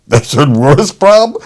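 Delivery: loud, slow, incredulous comeback; incredulous retort. Retold or imitated. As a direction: throw it back in disbelief. Nurse retorts to the father.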